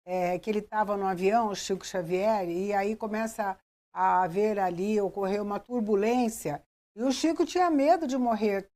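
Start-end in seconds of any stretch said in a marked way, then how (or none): none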